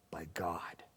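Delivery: hushed, breathless whisper. Real, not acted. Preacher on feeling forsaken by God, whispering. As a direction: let it fall to a bare whisper. Sermon climax on being forsaken.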